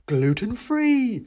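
high squeaky hawker voice; mouse seller. Acted. A mouse offers the narrator a pie, high and eager. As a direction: pitch it up like a cheery mouse. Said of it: "said the mouse".

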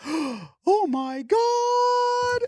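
very high, loud mock shriek; gasp, then squeal it long like an over-the-top mom; crazy reaction. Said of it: "the real crazy reaction".

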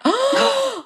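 high, shocked mock gasp. Wife caught out by partner, mock shocked gasp. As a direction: gasp in fake horror.

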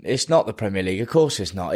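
ordinary delivery

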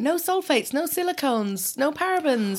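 high, soft label-speak; advertising voice. Retold or imitated. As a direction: mimic a product label pitch. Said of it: "brands are now doing are saying".

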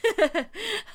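sudden high delighted laugh; high burst of laughter. Real, not acted. Reacts to news.

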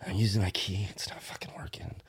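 hushed whispering as if at the door. Retold or imitated. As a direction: drop to a whisper, keeping it secret.

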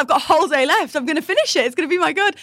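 high, loud, insistent promise to yourself; hammed-up determination; determined self pep talk.

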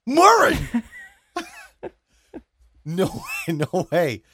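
high, loud shriek of the name; shocked outcry. Real, not acted. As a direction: cry out in scandalised disbelief. Shocked at the shirt's price.

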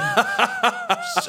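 sudden squeaky laugh; high embarrassed laugh. Retold or imitated. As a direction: burst out in a very high giggle. Laughing reaction to fan compliment.

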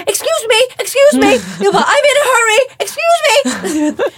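pushing through the plane aisle, high squeaky polite plea; overly polite plea. Retold or imitated. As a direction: squeal it sweetly while pushing past. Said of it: "Men jag sa det trevligt".

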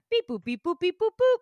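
singsong beeps jumping high at the end; imitated computer beeping. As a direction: mimic a machine processing, rising sharply. Imitates phone system working.

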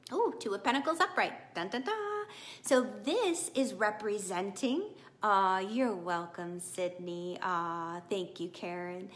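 normal talking voice

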